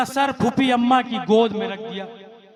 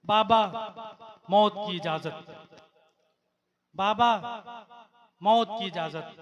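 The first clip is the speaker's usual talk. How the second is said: soft pleading son; 4 semitones lower, 5 dB quieter than usual. Acted. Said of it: "Hussain के पास आते हैं".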